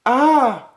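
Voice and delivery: sudden high loud shriek; a sharp yelp of fright; startled scream. Acted. Wife startled in the dark, high loud shriek.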